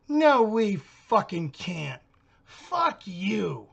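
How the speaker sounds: put-on loud, slow furious shout as the girl; furious neighbor. Man mimicking the neighbor cursing at him, slow furious shout. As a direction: spit it at him, dragged and hostile.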